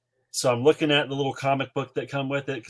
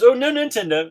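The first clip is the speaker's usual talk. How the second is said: high, loud refusal; mock kid whine; 13 semitones higher, 8 dB louder than usual. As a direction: whine it like an eight-year-old.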